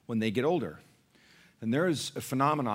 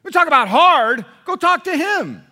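very loud, high teasing challenge; father daring his son to talk to grandpa; mock-shouted challenge; 15 dB louder than usual. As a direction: hurl the challenge with comic force. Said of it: "He says".

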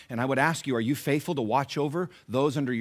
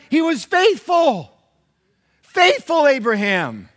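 loud, high, driving sermon delivery; high-energy preacher at full volume; full-force preaching; 11 semitones higher, 12 dB louder than usual. Real, not acted. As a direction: preach it out to the whole room, punching each phrase.

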